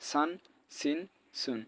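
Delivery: সান, সিন, সুন with a clear English s; sharp s at the start of সান, সিন, সুন; plain sin s. Shown as a demonstration. Teacher contrasting two letters, সান, সিন, সুন with plain s. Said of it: "সিনের সঙ্গে মিলে যাবে".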